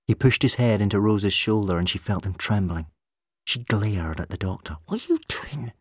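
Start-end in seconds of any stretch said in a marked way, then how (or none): 4.8-5.7 mouthed angrily. A narrator's reading. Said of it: "she mouthed at him angrily".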